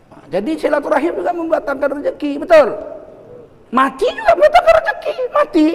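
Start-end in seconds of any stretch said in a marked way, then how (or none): 3.7-5.7 high, laughing punchline; cracking up while he says it. Acted. Preacher jokes to the congregation, laughing. Joking that death brings fortune.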